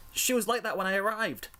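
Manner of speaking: high-pitched anxious excuse; defensive lie. A narrator's reading. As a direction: offer the cover story in a high voice. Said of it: "Rainbow Dash lied".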